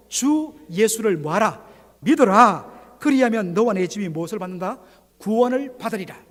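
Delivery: loud calling out the answer. Acted. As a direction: call it out to the congregation. Preacher calls out verse answers.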